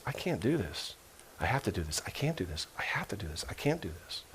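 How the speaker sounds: hushed, half-whispered self-argument; anguished inner voice. Acted. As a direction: act out torn thoughts almost under the breath. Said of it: "in his mind he's going through this".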